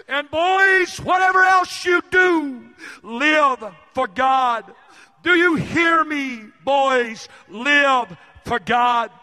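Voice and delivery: loud, high, urgent plea, slowing on key words; a broken father crying out to his boys to live for God; pleading father. Retold or imitated. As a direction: beg your sons with everything you have. Weeping father pleading with sons.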